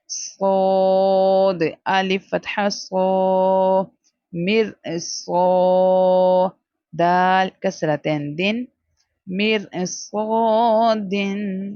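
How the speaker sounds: slow مر صادن with a full heavy saad. Shown as a demonstration. Teacher drilling heavy letters, slow heavy saad. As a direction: draw out صادن heavy and thick. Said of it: "موٹا کر کے پڑھیں گے".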